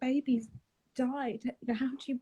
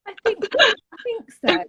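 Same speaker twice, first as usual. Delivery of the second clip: much higher, laughing through the words; 13 semitones higher, 11 dB louder than usual. Real, not acted. Telling her son how God arrives, laughing. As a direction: giggle out the answer.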